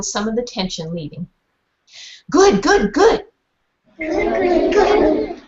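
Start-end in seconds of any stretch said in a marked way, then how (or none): 2.3-5.4 high, barky dog voice woofing the words. A narrator's reading. Dog woofs praise at the meeting, high barky voice. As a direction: bark each good like a happy dog. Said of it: "I woofed".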